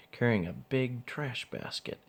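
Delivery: ordinary delivery, nothing marked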